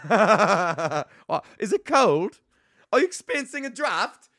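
high, put-on fussy voice asking about a draft; mock fussy host. A comic jokingly voicing a fussy worrier about a chill. Mock voice closing computer windows.

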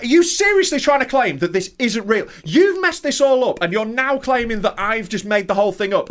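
high indignant outburst; yelling at the pharmacist over the prescription, high and indignant; outraged ranting. Retold or imitated. Retold losing it.

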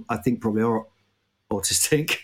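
normal talking voice

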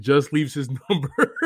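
words breaking into a laugh; amused laughing. Real, not acted. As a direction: laugh through the end of the phrase. Amused by the repeated phone calls.

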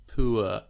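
"poor" as "poo-uh"; posh RP diphthong in "poor"; RP ua sound. Shown as a demonstration. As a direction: say "poor" with an oo-to-uh glide. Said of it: "the ua sound can shift to or".

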